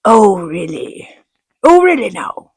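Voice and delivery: slow, sarcastic retort that rises in pitch; sarcastic oh really. Retold or imitated. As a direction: throw it back, put out and annoyed. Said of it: "So now I'm pissed".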